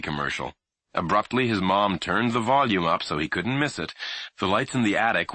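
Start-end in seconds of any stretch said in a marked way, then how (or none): none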